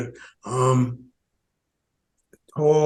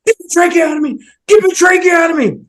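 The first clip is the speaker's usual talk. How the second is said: much higher, louder insistent command; insistent doctor; 13 semitones higher, 7 dB louder than usual. Retold or imitated. Head of ICU insisting the surgeon do the tracheotomy, loud and high. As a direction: bark the order like the ICU head who won't take no. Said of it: "Myrna went".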